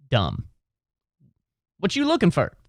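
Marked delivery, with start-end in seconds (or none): 1.8-2.6 much higher, "for" said as "fur"; mock it high, ending "for" like her; reduced final word. Shown as a demonstration. Repeating the dumb-sounding version he rejects, high "for" as "fur". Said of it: "I don't say that".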